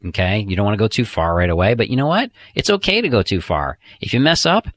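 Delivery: ordinary talk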